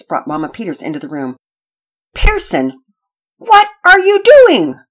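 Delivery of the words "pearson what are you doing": high, loud, slow motherly outburst; exasperated mother. Acted. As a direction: drag it out in disbelief. Mother finds boy practicing late.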